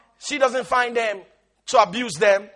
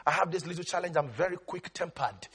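low, soft mock confession; excuse-making church member; 7 semitones lower, 12 dB quieter, faster than usual. Church member excusing a quick temper to the pastor, low and soft. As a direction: murmur a lame excuse to the pastor. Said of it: "I say, "You know, Pastor".

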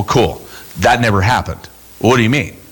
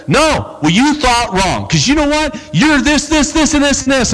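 lashing out; 10 semitones higher than usual. Retold or imitated. Lashing out at friend.

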